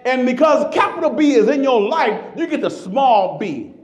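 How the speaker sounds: loud, high, slowed preaching; full-force preaching. Acted. Pastor preaching to the congregation, loud and high. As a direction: preach it out to the rafters.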